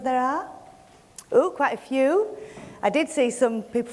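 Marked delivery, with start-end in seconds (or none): 1.3-2.3 pleased surprise. Real, not acted. Sees many hands in audience.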